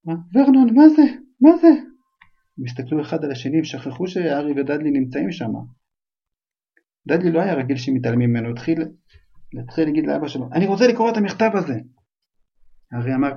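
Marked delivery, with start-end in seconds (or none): 0.1-1.9 panicked aunt. Acted. Aunt sees the frightening letter. 10.5-11.8 put-on whiny child. Spoiled child demands the letter.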